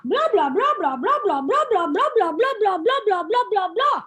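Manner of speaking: high, loud babbling blah blah; chattering blah-blah. Acted. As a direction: rattle off blah like endless chatter. Chad's chatter as blah sounds.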